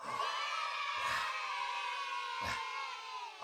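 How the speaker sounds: high, airy astonished gasp; a hammed-up gasp at the big reveal; mock gasp. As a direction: gasp as if you can't believe it. Shocked reveal in story.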